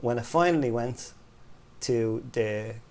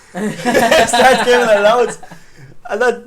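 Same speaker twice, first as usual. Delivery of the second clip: words squeezed out through a big laugh; laughing hard; 10 semitones higher, 12 dB louder, much slower than usual. Real, not acted. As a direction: laugh it out, high and loud and slow. Laughing at son's mockery.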